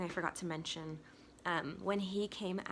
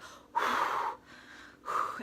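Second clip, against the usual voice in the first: long, forceful blown-out breath; heavy whooshing exhale; intense breathing; 3 dB louder than usual. Shown as a demonstration. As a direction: demonstrate labor breathing with a hard exhale. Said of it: "I did that, like, really intense".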